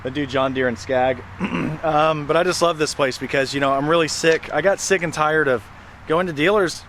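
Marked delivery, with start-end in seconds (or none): none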